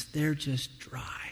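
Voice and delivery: slow hushed whisper. Acted. Preacher naming spiritual dryness, whispered slowly. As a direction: let it fall to a whisper.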